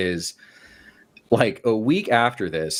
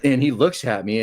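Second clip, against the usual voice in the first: normal talking voice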